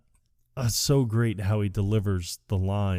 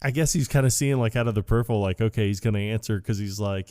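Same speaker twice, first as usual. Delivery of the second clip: usual speaking voice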